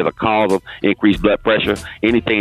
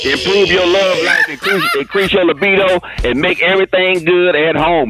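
ad read; 8 semitones higher than usual. Acted. High ad read for product.

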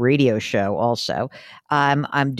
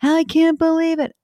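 much higher, louder amazed voice; imitating excited anchor; 13 semitones higher, 3 dB louder, slower than usual. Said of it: "He said".

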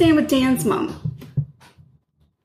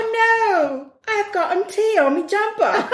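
high, tearful fake-British lament, half laughing; comic sobbing Brit in distress; mock British wail; 8 semitones higher, slower than usual. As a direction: wail in hammed-up British dismay. Playing a distressed Brit in jest.

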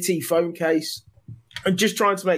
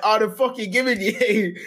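laughing; 3 semitones higher than usual. Retold or imitated. Laughing through retold reply.

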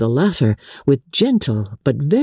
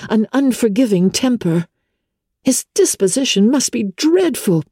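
put-on higher young woman's voice, shocked and indignant; Elizabeth, indignant; 7 semitones higher than usual. A narrator's reading. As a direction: rise up in disbelief at the injustice. Elizabeth recalling Darcy.

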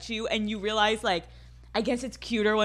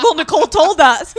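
mock fan voice; 6 semitones higher, 10 dB louder than usual. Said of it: "and be like".

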